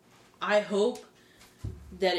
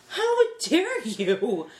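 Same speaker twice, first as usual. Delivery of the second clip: high, drawn-out outraged retort; mock indignation; 5 semitones higher, 5 dB louder, much slower than usual. Answering people who shrug it off, acted indignation. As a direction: act appalled, stretching the words out.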